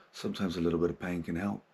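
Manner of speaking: usual speaking voice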